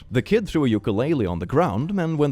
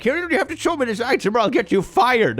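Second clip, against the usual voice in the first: mock demanding customer; 13 semitones higher, 3 dB louder than usual. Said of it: "they repeat like".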